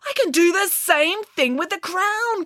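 high, slow, emphatic realization; excited cry. A narrator's reading. Scientist realizes how to measure the crown, excited cry. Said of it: "cried Archimedes".

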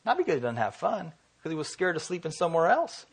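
normal talking voice

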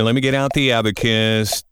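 ordinary talk, nothing marked

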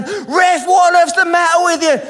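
high, loud yell at the referee; retold shout at the ref. Father yelling at the referee to protect his son, loud and high. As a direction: bellow it from the sidelines.

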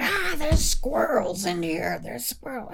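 high, loud panicked scream; screaming passengers. Acted. Passengers shriek at the squirrel in the cabin, high and panicked. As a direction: shriek it like you've seen a monster. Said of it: "they all started screaming".

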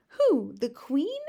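high, loud shocked question; aghast. A narrator's reading. Sophie learns the Queen is targeted, aghast.

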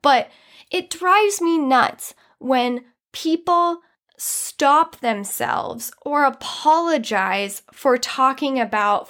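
ordinary talk

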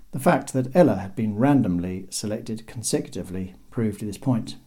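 ordinary delivery

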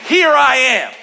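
loud, high, driving preacher's voice; preaching at full force. Acted. Pastor preaching to church, loud and fervent. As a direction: preach it hard to the congregation.

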